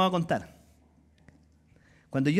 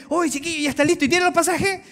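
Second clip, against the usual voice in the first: high, chirpy imitation of curious friends; eager well-wisher; 8 semitones higher than usual. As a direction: ask brightly, pitch climbing to the end. (imitando a un amigo entusiasmado)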